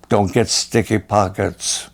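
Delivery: normal talking voice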